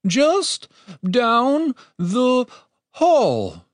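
high, halting, very slow and frightened; shaking voice. A narrator's reading. Terrified captive giving directions to intruders, voice shaking. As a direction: force out each word separately in terror. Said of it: "his voice shook with each word".

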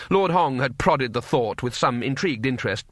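ordinary delivery

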